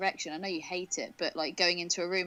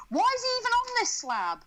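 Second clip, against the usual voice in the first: high, slow mock outrage; exaggerated disbelief, drawn out; incredulous; 11 semitones higher than usual. Real, not acted.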